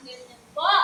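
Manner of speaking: normal talking voice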